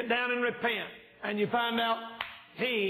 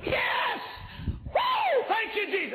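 joyful shout; slower than usual. Pretend. Preacher acts out getting a thousand bucks, ecstatic whooping shout. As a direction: burst out whooping with joy. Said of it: "I'll go".

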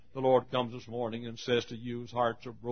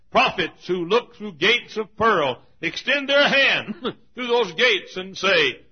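high, loud, slow pulpit oratory; ringing sermon delivery; dramatic preaching; 9 semitones higher, 10 dB louder, slower than usual. Acted. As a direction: proclaim the vision grandly, drawing it out. Preaches a vision of heaven.

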